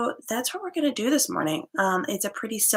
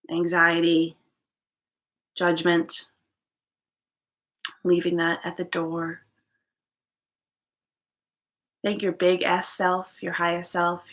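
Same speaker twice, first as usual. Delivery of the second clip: guided meditation. Acted. Yoga teacher leading listeners into gratitude and inward focus, guided meditation. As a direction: settle listeners into stillness, phrase by unhurried phrase.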